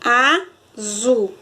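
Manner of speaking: "azul" as two separate beats, a, zul; teacher sounding out "azul"; syllable by syllable. Shown as a demonstration. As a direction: leave a gap inside "azul". Word split into syllables.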